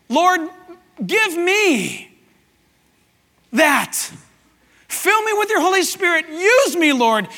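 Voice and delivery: high, pleading cry to God; fervent prayer. Acted. Preacher begging the Lord to fill him, fervently. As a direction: pray it hard, pausing between phrases.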